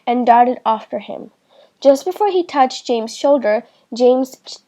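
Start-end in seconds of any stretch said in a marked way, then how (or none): none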